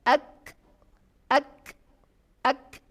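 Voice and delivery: ak held back, then released as a hiss of breath; ak demo. Shown as a demonstration. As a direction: hold the voice, then let ak out as breath. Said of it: "seperti yang saya praktekkan ini".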